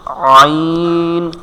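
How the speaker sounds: very slow throaty ʿayn; letter demo. Shown as a demonstration. Teacher draws out আইন very slowly for students. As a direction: stretch আইন from the middle of the throat. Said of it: "practice করার জন্য".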